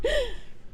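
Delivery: high, soft falling laugh; short laugh. Real, not acted. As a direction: let a small high laugh trail out. Laughs after own rant.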